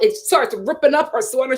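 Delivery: ordinary talk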